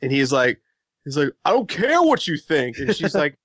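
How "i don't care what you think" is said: raised, higher-pitched quarrel voice; mock yelling bro. Bro overheard mid-match arguing with girlfriend, mock yell. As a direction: imitate a bro snapping at his girlfriend. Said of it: "he was yelling at his girlfriend".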